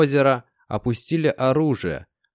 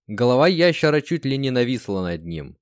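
plain reading voice

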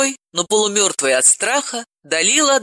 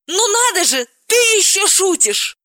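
very high, astonished cry; amazed exclamation; 3 dB louder than usual. Acted. Galina marvels that Dalila still jokes, very high. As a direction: gasp it out in disbelief. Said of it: "Галина воскликнула".

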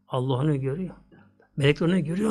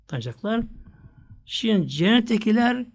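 ordinary talk

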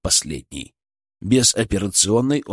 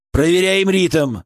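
louder, slow command; 6 semitones higher, 4 dB louder, slower than usual. A narrator's reading. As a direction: call the check firmly. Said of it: "скомандовал я".

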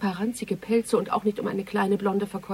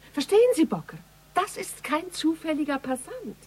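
urgent; 7 semitones higher than usual. Acted. Urgent realization to Bocker.

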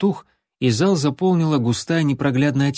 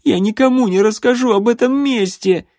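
high, loud desperate plea; terrified plea; 10 semitones higher, 5 dB louder than usual. Acted. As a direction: plead in panic. Terrified boy pleading with vampire.

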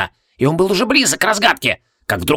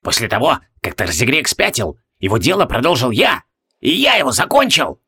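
triumphant cry. A narrator's reading. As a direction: build up to a proud exclamation. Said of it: "вскричал Себастиус".

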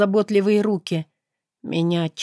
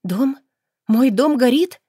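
higher and louder, rising in alarm; panicked disbelief; 7 semitones higher, 5 dB louder than usual. Acted. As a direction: cry it out as the horror sinks in. Heroine learns her house burns.

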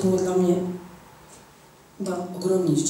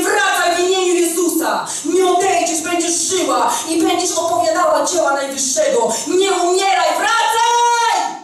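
high, loud pleading shout; desperate shout; 10 semitones higher, 4 dB louder than usual. Retold or imitated. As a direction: cry out to call her back. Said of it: "Krzyczałam do mojej mamy". (rozpaczliwy krzyk)

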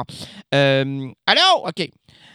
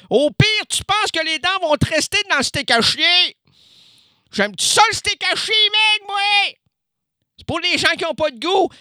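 loud rant; 10 semitones higher, 4 dB louder than usual. Acted. Ranting loudly about ground beef.

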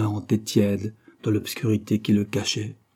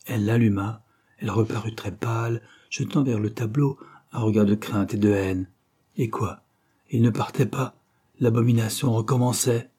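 usual speaking voice

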